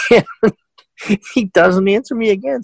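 high, drawn-out words through laughter; laughing while telling. Real, not acted. Storyteller cracking up over the silent intruder, laughing.

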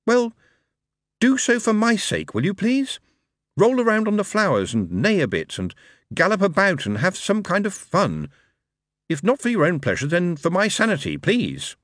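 high, pleading; exasperated plea to the horse. Acted. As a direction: beg the horse to have fun, voice up high.

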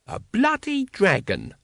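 high, slow, incredulous character voice; Mooty. A narrator's reading. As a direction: draw it out high in disbelief. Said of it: "said Mooty".

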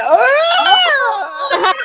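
very high, drawn-out "ooh" squeal; hammed-up smitten squeal; swooning squeal. Swooning at her honey's greeting.

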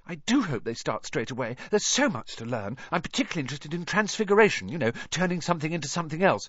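fast, eager schoolgirl; eager know-it-all girl. A narrator's reading. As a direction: rattle it off eagerly. Hermione talking about lessons.

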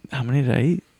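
ordinary talk

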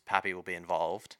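ordinary talk

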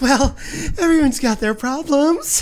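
high, laughing woman's voice. Retold or imitated. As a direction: chirp it out, half laughing. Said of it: "she's like".

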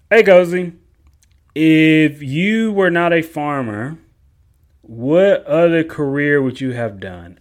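loud put-on voice for a viewer's question; reading viewer question. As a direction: call it out like an eager fan.

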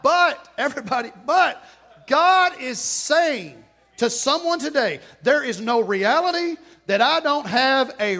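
full-force preaching. Acted. Pastor urging the congregation toward revival, full-force preaching. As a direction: preach it out with rising fire.

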